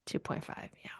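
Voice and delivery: soft whispered agreement; hushed whispered yes to the score. Real, not acted. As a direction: breathe the number out, voiceless.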